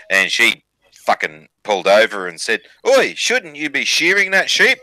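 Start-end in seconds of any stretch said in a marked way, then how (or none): 2.9-4.8 higher-pitched hail across a paddock; calling out. Retold or imitated. Blonde hails a farmer in a joke, calling out. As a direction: call it out as a challenge.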